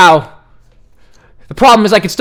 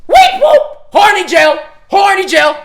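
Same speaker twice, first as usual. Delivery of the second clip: alarm shout; 10 semitones higher, 3 dB louder than usual. Real, not acted. Interrupting the steamy scene.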